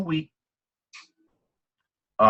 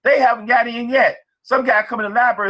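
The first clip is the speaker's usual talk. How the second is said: excited; 8 semitones higher than usual. Real, not acted. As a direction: pitch jumps high with delight. Excited retelling of son's success.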